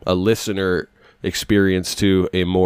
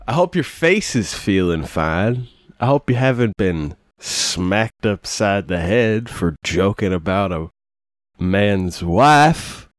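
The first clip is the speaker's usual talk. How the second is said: put-on southern accent; slower than usual. Host greeting listeners as partner, in a southern accent. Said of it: "I'm doing a southern accent".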